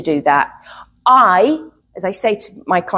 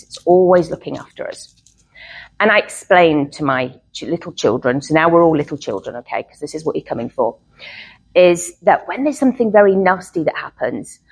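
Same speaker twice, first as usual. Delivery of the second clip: ordinary delivery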